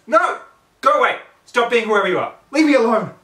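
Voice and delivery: very loud, high shrieks; screaming. Acted. Twilight screaming at friends to go away. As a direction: scream them off.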